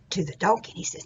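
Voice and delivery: ordinary delivery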